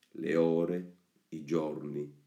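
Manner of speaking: plain reading voice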